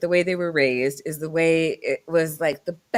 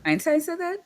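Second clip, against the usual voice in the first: surprised. Real, not acted. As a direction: pitch shoots up in disbelief. Surprised at the quote.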